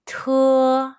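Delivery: "Te" held long, high and loud, t strongly aspirated; exaggerated aspirated t. Shown as a demonstration. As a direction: demonstrate "Te" with an exaggerated puff of air. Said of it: "always good to exaggerate the pronunciation".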